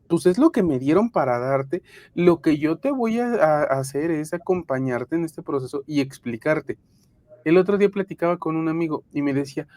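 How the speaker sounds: usual speaking voice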